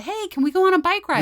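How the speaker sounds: put-on bouncing kid begging to go out; eager kid voice. Kids asking, parent voices them.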